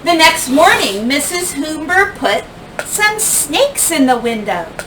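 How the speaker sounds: ordinary delivery, nothing marked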